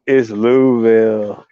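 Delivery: "Louisville" slowly as two syllables, LOO-vil; imitating a local correcting "Louisville" to LOO-vil; local pronunciation. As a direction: drop the EE from "Louisville" and stretch it. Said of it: "And they was like".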